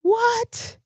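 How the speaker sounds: very high, breathless disbelief; shocked what. Real, not acted. Stunned at hearing a bottle was thrown. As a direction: squeak it out in shock.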